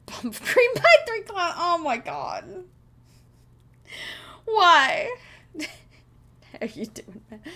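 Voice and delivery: helpless real laughter through the words; high, drawn-out words breaking into laughter; cracking up. Real, not acted. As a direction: barely get it out for laughing. Cracks up reading chat names.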